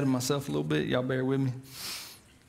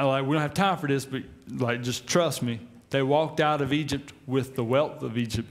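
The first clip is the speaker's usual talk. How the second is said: ordinary talk, nothing marked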